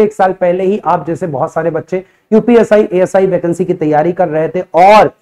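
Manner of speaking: ordinary talk, nothing marked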